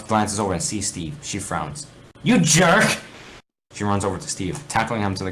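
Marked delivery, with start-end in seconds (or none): 2.2-3.0 high, loud furious yell; angry shout. Acted. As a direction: lash out at him. Alex angry at Steve.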